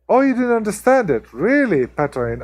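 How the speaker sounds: hammed-up disbelief; mock surprise. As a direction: play up the shock to tease him. Teasing viewer who missed accent joke.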